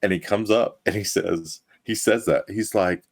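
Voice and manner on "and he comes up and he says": ordinary talk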